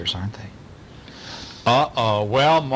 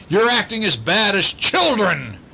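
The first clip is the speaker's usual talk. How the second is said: higher, louder scolding yell; 10 semitones higher, 4 dB louder than usual. A narrator's reading. Uncle scolding misbehaving monsters, yelling. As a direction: scold them like a cross uncle. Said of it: "he yelled".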